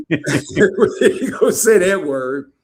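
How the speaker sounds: laughing as he repeats the tease. Real, not acted. Host teasing guest about an overused word, slow and laughing.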